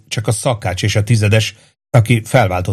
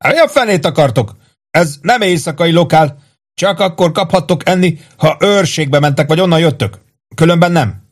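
higher-pitched irritated cook; irritable scolding, pitched up; 8 semitones higher than usual. A narrator's reading. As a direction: grumble testily at unwanted visitors. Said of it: "A szakács ingerülten fölült".

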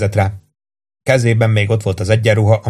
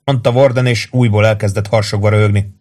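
ordinary delivery, nothing marked

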